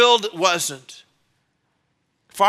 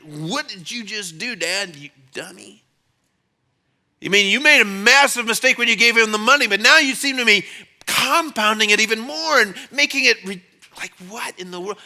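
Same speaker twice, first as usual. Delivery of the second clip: incredulous son; 4 semitones higher, 4 dB louder than usual. Retold or imitated. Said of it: "looking at him like".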